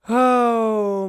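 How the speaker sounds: high, loud delighted oh; light up at the name; excited oh. Real, not acted. Delighted recognition.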